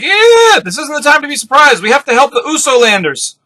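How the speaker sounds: very high, loud squeaky squeal easing down; hammed-up high-pitched urgency; little folk crying out. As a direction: pipe up like a panicked tiny creature. Crowd of little characters cries out.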